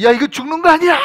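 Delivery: loud, high cry of alarm; panicked shouting. Acted. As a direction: yell it like the boat is going over. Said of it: "막 소리치고".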